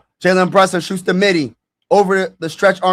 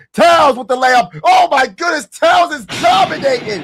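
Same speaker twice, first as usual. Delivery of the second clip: high, loud amazed call; excited shout; 8 semitones higher, 4 dB louder than usual. Real, not acted. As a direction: blurt it out in disbelief.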